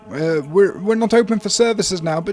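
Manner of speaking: high, loud priest voice from below; cleric calling back. Acted. Cleric tells visitors services are closed, calling back. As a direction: call up loudly to unexpected visitors. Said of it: "you hear a voice coming back".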